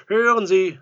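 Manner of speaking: very loud, high interruption; cutting in. A narrator's reading. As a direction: break in over his protest. Visitor cuts him off.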